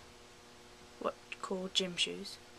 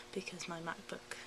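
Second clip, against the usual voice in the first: ordinary talk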